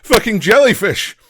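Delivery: pained scream, high and loud. Acted. Man stung on the beach screams in pain. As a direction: yell it out in stinging pain. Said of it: "screams the man on his back".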